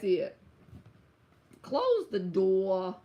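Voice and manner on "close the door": put-on slow, stretched New York accent. Showing off the one line they have down, in a slow New York accent. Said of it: "I got that one line down".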